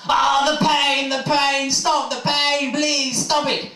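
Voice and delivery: high, slow, pained moaning in labour; woman in labour. Acted. Woman in labour begging her partner, high drawn-out pained cries. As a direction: wail through the contractions, dragging it out. Acting out woman giving birth.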